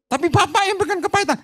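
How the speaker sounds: high-pitched mock complaint; high whiny protest. Congregant blaming the pastor, high whiny protest. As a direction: whine it like an offended member.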